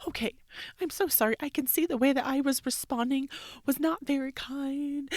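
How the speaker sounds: put-on high, loud voice of a sorry child; daughter's apology. Mother voicing daughter's apology, high and loud. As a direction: play the tearful twelve-year-old saying sorry. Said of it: "then she's like".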